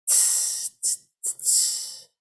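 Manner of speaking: voiceless tss cymbal beats; hissed cymbal sounds. Shown as a demonstration. Goddess demonstrating the cymbal beat, hissed. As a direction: hiss out a hi-hat rhythm. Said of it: "My turn".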